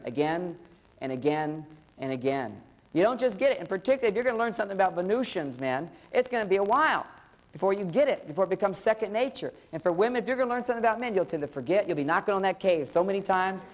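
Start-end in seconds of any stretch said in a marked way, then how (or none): none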